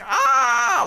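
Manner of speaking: high, loud, anguished Russian scream. Acted. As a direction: shriek out in agony. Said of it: "Like that".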